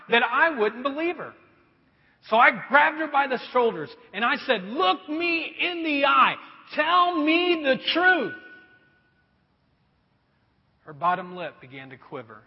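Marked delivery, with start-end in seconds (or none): 4.6-8.3 slow, firm and higher; stern demand. Retold or imitated. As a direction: lay down the law slowly. Father demands truth from daughter.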